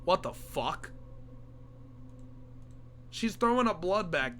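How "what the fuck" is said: ordinary talk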